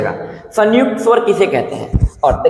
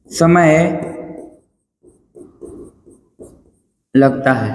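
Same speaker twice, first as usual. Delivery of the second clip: word by word, long pauses between; slow dictation; 3 semitones lower, slower than usual. Real, not acted. Teacher dictating notes for students to write, slow dictation. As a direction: dictate in separate, firm chunks.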